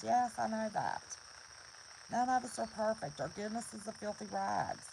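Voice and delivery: ordinary talk, nothing marked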